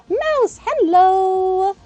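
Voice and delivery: high, loud delighted hello; excited greeting. Real, not acted. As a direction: greet a friend with a gasp. Excited greeting to chat viewer.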